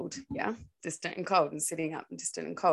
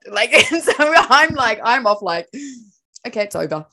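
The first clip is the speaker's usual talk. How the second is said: laughing; 4 semitones higher, 11 dB louder than usual. Real, not acted. Laughing while retelling date.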